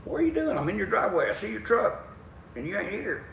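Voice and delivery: high-pitched voice of a buddy calling up; Dana. Retold or imitated. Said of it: "He says".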